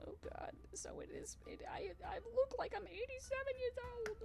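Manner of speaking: high, breathy whispered dismay; whispered mock despair at looking old. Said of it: "I'm like".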